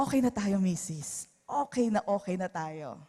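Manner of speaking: soft, falling, conciliatory tone; gentle reconciling. Acted. Hurt husband to wife, gently making peace. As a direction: let it drop into a tender truce. Gives in lovingly despite being hurt.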